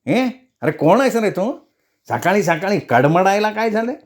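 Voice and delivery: put-on high, querulous old man's voice; cranky elder disturbed in the morning; grumpy old man. A narrator's reading. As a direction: grumble at an early caller in a high old voice. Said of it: "एक म्हातारा डोकावला".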